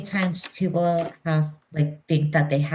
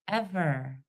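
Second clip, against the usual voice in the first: normal talking voice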